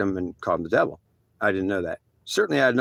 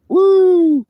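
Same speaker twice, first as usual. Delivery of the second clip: a high, loud whoop of glee; loud excited whoop; 11 dB louder than usual. Retold or imitated. New door gunner whooping at his big gun, loud high whoop. Retold thrill at helicopter gun.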